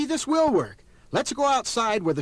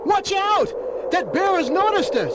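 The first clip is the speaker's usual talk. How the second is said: alarmed warning; 4 semitones higher than usual. Acted. Fred warns friends as the bear charges, alarmed shout. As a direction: warn the others the bear is coming.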